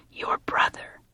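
hushed, breath-only whisper; vehement murmur. A narrator's reading. Wife murmuring in her husband's ear, whispered. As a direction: whisper it urgently under the breath. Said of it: "She murmured again, more vehemently".

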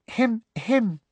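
high, simpering little cough; Umbridge imitation. A narrator's reading. Ginny mocking Umbridge, high simpering cough. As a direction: mimic Umbridge's throat clearing. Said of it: "good imitation of Professor Umbridge".

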